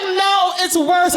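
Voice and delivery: put-on high, urgent child's voice; high little-girl panic; 1 panicked little girl. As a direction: squeal it like a scared four-year-old. Daughter voiced again.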